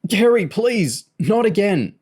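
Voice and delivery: high, loud, pained begging; gasped plea. A narrator's reading. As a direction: gasp out a desperate plea. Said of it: "James gasped".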